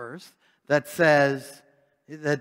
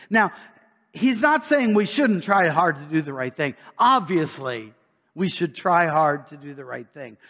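raised, driving preacher voice, a bit louder; lively preaching; 5 semitones higher, 6 dB louder than usual. Acted. Preacher challenging the congregation, a touch louder and driving. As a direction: press the point home to the flock.

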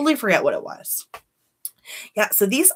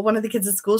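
usual speaking voice

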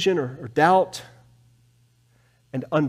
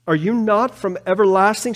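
higher, rising plea; pleading question; 6 semitones higher than usual. Acted. Habakkuk crying back to God, pleading upward. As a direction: lift the question toward God.

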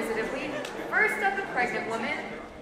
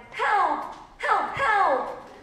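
high, loud, repeated calls; cries for help; 7 semitones higher, 6 dB louder, much slower than usual. A narrator's reading. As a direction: call out for rescue. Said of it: "They all cried".

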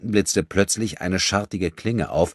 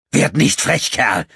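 higher, loud menace; threatening; 8 semitones higher, 8 dB louder, slower than usual. Acted. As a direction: warn him off, menacing. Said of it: "sagte Enrico drohend". (drohend, laut)

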